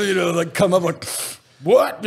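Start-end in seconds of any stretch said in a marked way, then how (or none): 1.6-2.0 high, loud dazed "What?". Retold or imitated. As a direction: snap awake confused. Dazed after waking up.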